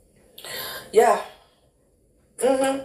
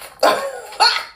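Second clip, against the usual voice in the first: high laugh. Real, not acted. Laughs at the eruption.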